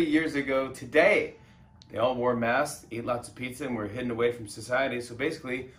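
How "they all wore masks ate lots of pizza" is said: usual speaking voice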